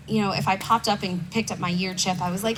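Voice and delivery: ordinary delivery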